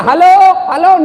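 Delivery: loud, high-pitched hello; acted yell down the line; mock shout. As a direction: shout into a phone that isn't working. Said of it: "Dia teriak".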